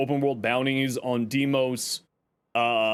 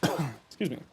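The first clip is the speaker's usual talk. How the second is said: coughing; 3 semitones lower, 5 dB quieter than usual. Real, not acted. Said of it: "now I can't stop coughing".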